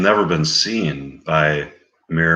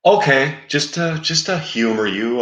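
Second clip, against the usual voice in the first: higher, sighing reluctance; reluctant give-in; 8 semitones higher than usual. Retold or imitated. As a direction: humor him grudgingly. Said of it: "it's like".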